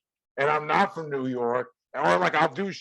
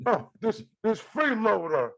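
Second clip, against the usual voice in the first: impression; 7 semitones higher than usual. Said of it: "let me do Ed Reza".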